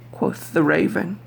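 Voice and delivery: ordinary delivery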